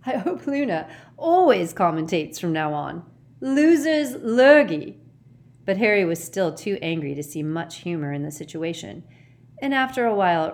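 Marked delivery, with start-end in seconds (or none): 0.1-5.0 gleeful, building to a loud finish; amused, swelling higher and louder; shaking with laughter. A narrator's reading. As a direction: relish the joke, drawing out the end. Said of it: "his voice now shaking with laughter".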